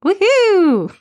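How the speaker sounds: high, loud whoop; cheering. Real, not acted. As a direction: cheer like a happy crowd. Cheering after shout out list.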